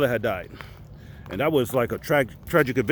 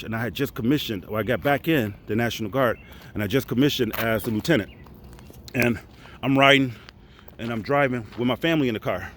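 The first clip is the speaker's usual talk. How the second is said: usual speaking voice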